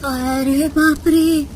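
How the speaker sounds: soft, slow, scared hush; frightened gasp. Acted. Aunt sees the witch at night, scared hush. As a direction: gasp in fright under your breath.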